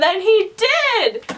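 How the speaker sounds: high, drawn-out exclamation; amazed punchline. Real, not acted. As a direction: land the unbelievable payoff slowly. Punchline of the coincidence.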